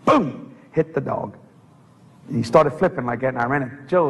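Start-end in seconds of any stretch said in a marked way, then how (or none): none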